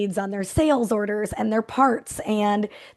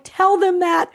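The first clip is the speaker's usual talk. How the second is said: higher, louder burst; urgent encouragement; 9 semitones higher, 5 dB louder, slower than usual. Retold or imitated. Coach urging her manager, higher and louder. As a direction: blurt it out, eager to push him.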